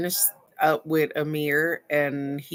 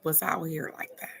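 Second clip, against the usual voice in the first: hushed whisper; 8 dB quieter than usual. Real, not acted. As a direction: drop to a whisper. Realizing her sister was homeless.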